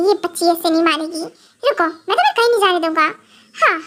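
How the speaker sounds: usual speaking voice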